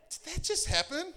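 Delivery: gobsmacked disbelief. Retold or imitated. Pastor reliving his shock at the stranger, stunned disbelief. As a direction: thrown for a loop, can't believe it. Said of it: "I'm like".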